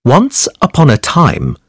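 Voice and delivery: ordinary delivery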